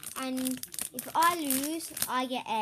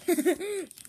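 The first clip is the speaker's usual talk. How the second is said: mouth-full laugh; 6 semitones higher, 6 dB louder than usual. Real, not acted. Laughs at own slip.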